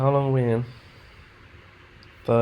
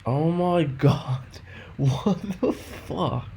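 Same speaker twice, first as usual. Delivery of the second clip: slow, high, laughing disbelief; stunned disbelief; 5 semitones higher, 3 dB louder, much slower than usual. Real, not acted. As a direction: gasp it out, can't believe the win. Trade suddenly hits target.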